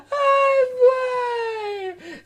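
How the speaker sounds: put-on high loud wailing oh boy; exasperated cry. Comedian wails to the audience, high and loud. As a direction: cry it out to the crowd. Comic exclamation between jokes.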